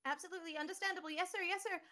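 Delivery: high, quick yes-sir robot; eager robot. Acted. BB answering the captain, high and eager. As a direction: snap to it like a nervous little droid.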